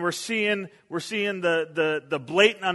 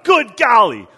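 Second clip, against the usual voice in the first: loud, higher "Good golly!" blurted out; startled yell; 5 semitones higher, 13 dB louder than usual. Retold or imitated. Pastor startled by rabbit at car yells in fright. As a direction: shout it like a bunny just startled you. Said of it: "I just yelled really loud".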